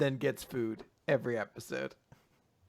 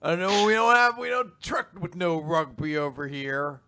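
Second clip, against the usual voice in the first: bully threat; 3 semitones higher, 9 dB louder than usual. Retold or imitated. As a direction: lay down the law loudly.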